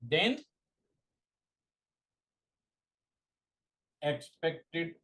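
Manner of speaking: word-by-word dictation with long pauses; slow dictation. Real, not acted. Teacher dictating a definition to exam students, slow dictation. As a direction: dictate slowly, leaving time to write.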